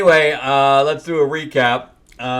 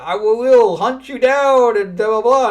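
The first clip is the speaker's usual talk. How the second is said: mock threat; 11 semitones higher, 7 dB louder than usual. Guardian threatening.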